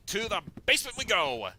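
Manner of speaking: high, loud rallying call; loud cheery march order; excited. Real, not acted. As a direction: announce it like setting off on an adventure. Heading off to explore.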